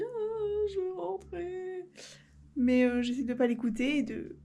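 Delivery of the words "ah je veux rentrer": high, slow sing-song wail; a homesick inner voice half-sung; whiny inner voice. Retold or imitated. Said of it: "une partie de moi qui se dit".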